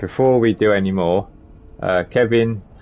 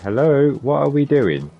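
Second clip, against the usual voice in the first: calling out; 7 semitones higher, 4 dB louder than usual. Real, not acted. Calling to stuck game character.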